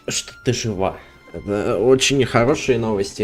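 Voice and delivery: ordinary delivery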